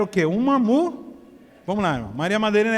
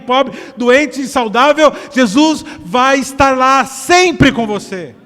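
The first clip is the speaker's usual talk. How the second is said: much louder, rhythmic fervent preaching; preaching at full force; 5 semitones higher, 10 dB louder than usual. Real, not acted. Pastor assures the church of God's love, fervent preaching. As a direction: proclaim it to the back row.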